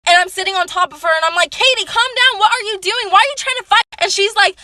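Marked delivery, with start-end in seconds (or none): 1.5-3.9 high, loud pleading imitation; acted high-pitched exasperation; retold urgent shouting. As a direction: shout her down mid-fight. Acted shouting during retold fight.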